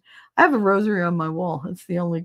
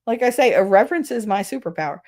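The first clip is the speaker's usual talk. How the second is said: normal talking voice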